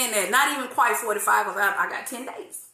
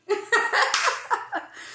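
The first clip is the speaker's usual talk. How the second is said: breathy laugh; 11 semitones higher than usual. Real, not acted. Live chat laughing.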